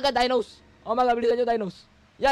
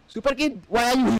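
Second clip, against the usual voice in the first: ordinary talk, nothing marked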